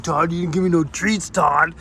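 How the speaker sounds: lightly voiced grumbling dog; dog's voice complaining. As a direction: mildly whine like the dog who got no treats. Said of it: "He's like".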